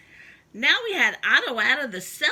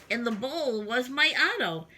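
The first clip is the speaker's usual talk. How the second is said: plain reading voice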